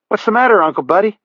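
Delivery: put-on boy's voice. A narrator's reading. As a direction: voice the young boy at the door. The boy Raymond calls from the door.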